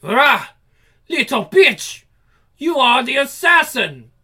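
hammed-up comic-book villain yell; snarling villain. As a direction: spit the insult like a cornered killer. Comic villain shouting lines.

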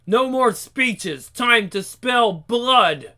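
villain shout. Acted. Villain shouts before attack.

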